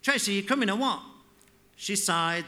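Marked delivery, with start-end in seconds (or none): 0.0-1.0 loud, higher impatient shout. Acted. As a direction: call her again, fed up. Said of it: "Pogo shouted".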